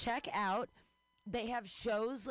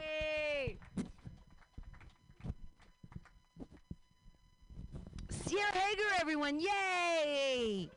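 rally the room, much higher; host cheer; 12 semitones higher, slower than usual. Real, not acted.

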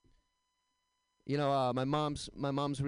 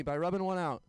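ordinary talk

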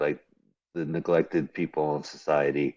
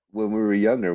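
normal talking voice

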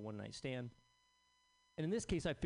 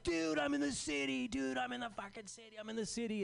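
excited buddy; 9 semitones higher than usual. Retold or imitated. Friend calling from the city, all hyped up. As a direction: mimic a hyped friend hollering he's in town.

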